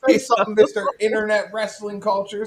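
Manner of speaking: high, loud teasing call-out; mock challenge. Co-host calling out a commenter for laughs, mock taunting. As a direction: goad them loudly, pitched well up.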